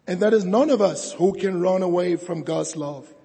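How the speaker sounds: fervent preacher building to a climax; preaching at full force. Acted. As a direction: preach it out to the whole congregation.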